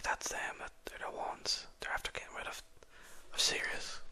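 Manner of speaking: hushed whispered gossip. Acted. Tavern patrons whispering about the party, hushed whisper. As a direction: whisper it like talk behind their backs.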